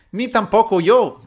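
very high, loud, drawn-out squawk; shrill slow exclamation; loud protest. A narrator's reading. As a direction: squeal it out loudly in protest. Pepita chimes in, indignant.

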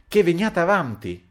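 impatient count shouting at Fanny; shouted order. A narrator's reading. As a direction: bark it at the stubborn maid. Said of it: "gridò il conte".

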